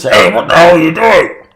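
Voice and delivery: shouting from inside a sack; loud and high, muffled by a bag. Acted. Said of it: "Ragda's muffled voice is".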